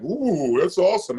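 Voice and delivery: mock delight. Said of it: "We're like".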